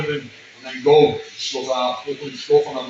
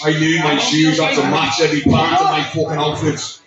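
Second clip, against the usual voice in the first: ordinary talk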